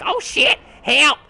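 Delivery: high, loud mock panicked yelp; squealed comic cry for help; retold panic. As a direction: yell for help in hammed-up alarm. Panics as mobs chase after.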